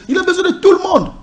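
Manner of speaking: laughing, high and quick. Real, not acted. Guest laughing as he names everyone the candidate needs, laughing. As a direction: breaks into a laugh as he rushes the list. Laughing while listing supporters.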